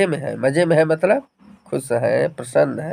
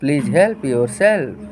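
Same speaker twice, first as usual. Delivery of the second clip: very slow model phrase; much slower than usual. Shown as a demonstration. Teacher dictating the English phrase very slowly. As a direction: stretch each word out slowly. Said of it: "तो क्या कहेंगे तब?".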